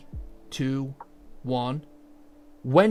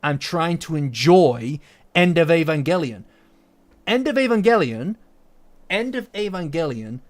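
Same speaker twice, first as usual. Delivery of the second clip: rising pitch, louder, worked up; agitated rant; 4 semitones higher, 5 dB louder than usual. Real, not acted. Ranting about talkers in the cinema, loud and worked up. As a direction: let the irritation boil over. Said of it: "now I'm getting agitated".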